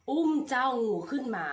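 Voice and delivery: usual speaking voice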